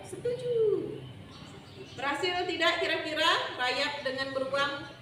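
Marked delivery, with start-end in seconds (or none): 0.1-0.5 very high, squeaky little termite cheer. Acted. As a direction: agree in a tiny high voice. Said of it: "kata, kata rayap". (suara rayap kecil melengking)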